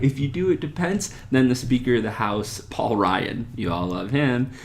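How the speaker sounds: ordinary delivery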